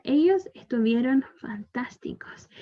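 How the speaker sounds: whisper. Real, not acted. Whispered aside.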